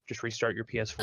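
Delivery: imitating a calm voice, low and soft. Quoting whoever calmly stepped in during the screaming, low and calm. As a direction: drop low and quiet, unruffled. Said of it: "came in and calmly said".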